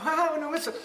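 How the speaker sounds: high, thrilled exclamation; a country man delighted at the novelty; excited farmer. Retold or imitated. Farmer waking at midnight, excited and high.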